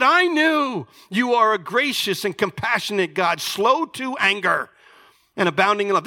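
acted seething, high and emphatic; mock fury through gritted teeth; gritted-teeth anger. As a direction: throw the praise back at God as an accusation. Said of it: "he's gritting his teeth as he's talking to God".

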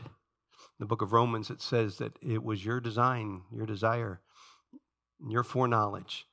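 low, softened praying voice; earnest prayer. Real, not acted. Leads congregation in prayer.

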